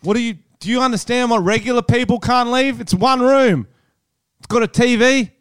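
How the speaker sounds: high, loud furious rant at celebrities; angry rant. Real, not acted. Mate ranting at rich celebrities on lockdown: loud, high, furious.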